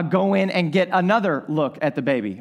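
ordinary talk

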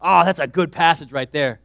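usual speaking voice

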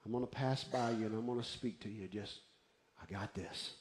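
low, soft, fading; God's quiet answer. Acted. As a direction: settle him down, sinking toward a hush. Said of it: "and he says".

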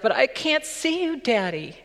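high scared cry; son crying out. Retold or imitated. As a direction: plead from the smoke. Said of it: "the son cries out".

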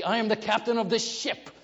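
captain shouting threats with a laugh; furious captain. Retold or imitated. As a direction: bark orders as an enraged captain. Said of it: "And then I say".

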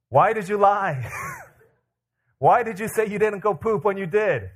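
mock-stern question through laughter; laughing demand.

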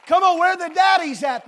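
loud, high call to the fathers. Real, not acted. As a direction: shout out to rouse the dads. Preacher calling out to congregation.

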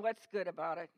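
low, sour comeback; grumpy retort. Retold or imitated. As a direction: grumble it back. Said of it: "someone like that in another congregation".

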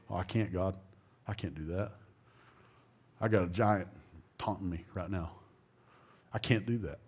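low, soft reluctant pleading, put on; mock defeated. Believer telling God he can't, mildly mock defeated. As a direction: act out giving up, voice dropping away. Believer balking at God's call.

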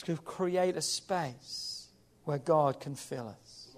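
slow, soft and low; low, hushed preacher's refrain; hushed key point. Real, not acted. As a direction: drop right down and let it sink in.

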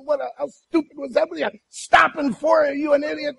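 high-pitched furious retold yelling; angry hollering. Husband yells at wife in car, angry. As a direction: berate her for stopping. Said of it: "just hollering at her and screaming".

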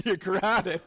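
said through a laugh; a chuckling verdict; a listener blurts the dad's reply; laughing answer. Real, not acted. Congregant answers as the dad.

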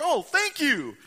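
high, loud delighted cry; delighted thanks. Acted. Seahawks fan thanking angel for ticket, high delighted cry. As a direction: voice a fan thrilled with his gift.

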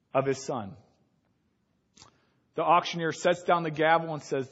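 usual speaking voice

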